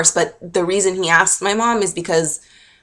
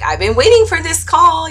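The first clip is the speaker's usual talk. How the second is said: put-on eager girlfriend; 10 semitones higher, 4 dB louder than usual. Voicing girlfriend on phone.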